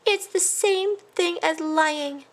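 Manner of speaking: high, slow sad voice; unhappy mouse. A narrator's reading. A sad mouse slowly explains lying. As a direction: say it slowly and sadly. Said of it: "unhappy little mouse".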